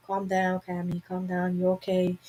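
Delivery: gentle self-soothing murmur; calming self-talk. Retold or imitated. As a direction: talk yourself out of panic, quietly. Retold self-talk during panic attack.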